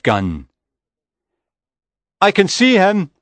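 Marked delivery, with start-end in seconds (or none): 2.2-3.1 high-pitched excited shout. A narrator's reading. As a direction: call it out high to those behind you. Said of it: "I shouted".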